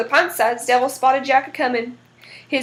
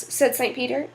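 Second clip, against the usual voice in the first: plain reading voice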